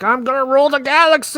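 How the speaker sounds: high, fast mock villain boast; a hammed-up cartoon villain plotting conquest. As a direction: camp up an evil overlord. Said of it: "He's like".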